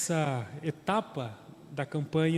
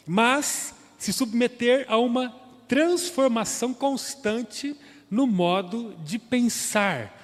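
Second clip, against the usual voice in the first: higher, louder sermon voice rising to a peak; fervent preaching; 6 semitones higher, 6 dB louder than usual. Acted. Pastor urging the church with rising fervent preaching. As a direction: drive the point home with preacher's fire.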